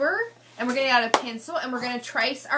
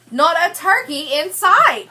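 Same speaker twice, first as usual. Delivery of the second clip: higher and louder on the punchline; dramatic reveal; 4 semitones higher, 8 dB louder, slower than usual. Acted. Reading the book's twist to the class, louder and dramatic. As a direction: land the surprise for the kids. Dramatic story line read aloud.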